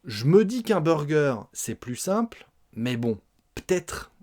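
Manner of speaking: ordinary talk, nothing marked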